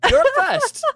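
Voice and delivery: high, loud teasing call-out; excited call-out. Real, not acted. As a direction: put them on the spot, gleefully. Host teasing guest, playful shout.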